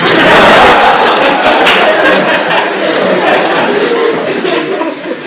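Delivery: loud, wheezing laugh fit; loud burst of laughter. Real, not acted. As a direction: crack up hard. Bursts out laughing mid-story.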